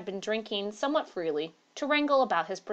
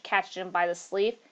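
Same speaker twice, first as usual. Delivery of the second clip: ordinary delivery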